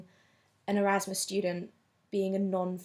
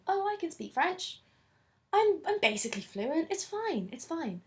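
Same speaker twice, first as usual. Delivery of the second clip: much higher, airy, self-assured mock chirp; breezy overconfidence; 9 semitones higher than usual. Mocking her past self heading to France, breezy and overconfident. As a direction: play your old cocky self brushing off worries. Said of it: "So I went away thinking".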